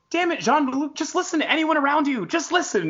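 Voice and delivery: high-pitched frustrated scolding; exasperated plea. Retold or imitated. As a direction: plead as if yelling at the TV. Acting out frustration at character.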